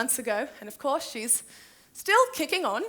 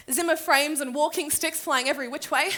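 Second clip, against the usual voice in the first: ordinary delivery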